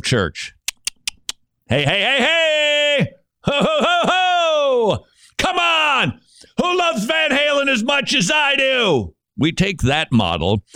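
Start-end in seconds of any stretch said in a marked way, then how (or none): 1.7-9.1 a shouted, very high rock-concert hype man; mock hype preacher. Mocking American church model, shouted high as a hype man.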